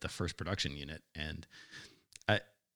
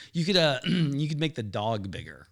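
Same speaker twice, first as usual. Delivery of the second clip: louder, higher imitated repeat; acting out a colleague repeating himself louder; Harry; 7 semitones higher, 7 dB louder than usual. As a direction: clear your throat and speak up.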